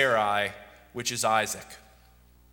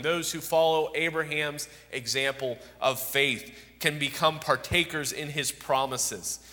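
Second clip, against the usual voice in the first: ordinary delivery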